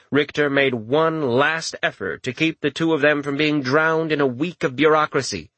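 ordinary delivery, nothing marked

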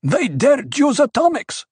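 high outraged protest. A narrator's reading. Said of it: "a trooper near Paul protested".

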